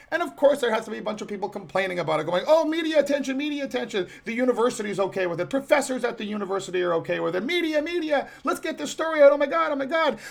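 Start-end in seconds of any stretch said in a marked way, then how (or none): 2.5-10.2 high, mock-hysterical whine; mocking whiny panic. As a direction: parody the fussing crowd, pitched up. Mocking the complaining professors.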